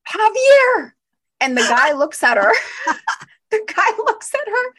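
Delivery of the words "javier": high, loud delighted call of a name; thrilled recognition. Retold or imitated. Friend spots her dance partner walking in, high loud thrilled call. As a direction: call out his name like a long-lost friend walked in. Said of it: "She's like".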